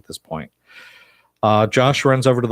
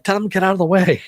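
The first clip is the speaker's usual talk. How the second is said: high, rushed warning; mock-frantic order, pitched way up; urgent call; 10 semitones higher, 4 dB louder, much faster than usual. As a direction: wave them off in a raised, hurried voice. Acted urgent call from roof.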